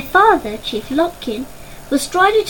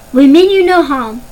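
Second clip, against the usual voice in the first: louder, firm call into the dark; 2 semitones higher, 8 dB louder than usual. Acted. Chief Lopkin reassuring the stranger in the tunnel, loud firm call. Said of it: "Lopkin called out again".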